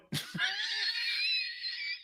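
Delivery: shrieking wheeze of laughter; helpless high-pitched cackle; high squealing laugh. Real, not acted. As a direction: laugh till the voice cracks up high. Breaks up over the story.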